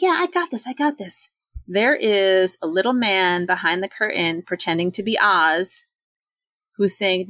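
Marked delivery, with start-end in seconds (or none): 0.0-1.3 cocky confidence. Retold or imitated. Feeling confident.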